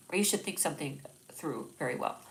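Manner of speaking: ordinary talk, nothing marked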